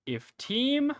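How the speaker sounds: high, ringing host's announcement; Lord Kirschwood. Acted. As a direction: call it out like a ceremony host. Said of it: "and he goes".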